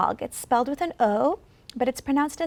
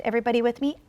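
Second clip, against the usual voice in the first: ordinary delivery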